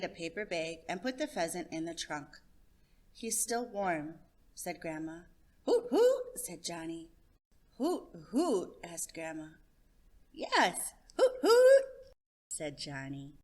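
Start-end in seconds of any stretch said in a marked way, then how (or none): 3.1-4.2 slow grandma. Acted. Grandma softly notes. 5.6-6.4 high, loud hooting call; a boy calling like a bird. Acted. Child imitates bird call. 7.8-8.8 a touch of puzzlement; grandma echoing the strange word; puzzled question. Acted. 10.3-11.8 high, loud hooting call; a boy calling like a bird. Acted.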